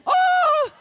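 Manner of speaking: very high loud exclamation; surprised oh. Real, not acted. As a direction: burst out in mock surprise. Sudden exclamation at the crowd.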